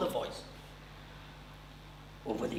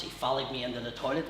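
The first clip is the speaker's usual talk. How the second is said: ordinary talk, nothing marked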